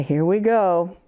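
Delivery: plain reading voice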